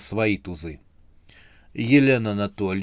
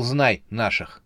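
plain reading voice

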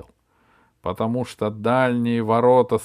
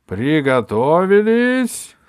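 slow, high, loud command; shouting; 8 semitones higher, 11 dB louder, slower than usual. Acted. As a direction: yell the command to the knights. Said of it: "закричал судья".

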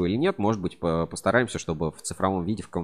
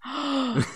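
sharp gasp. Real, not acted. Shocked gasp at the punchline.

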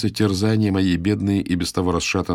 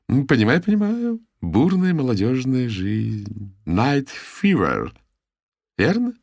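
familiar teasing; 6 semitones higher than usual. A narrator's reading. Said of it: "фамильярно подмигивая".

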